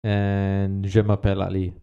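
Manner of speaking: normal talking voice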